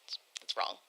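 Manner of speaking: breathy whispered aside. Real, not acted. Reading fan write-ins, whispers an aside. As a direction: whisper it under your breath.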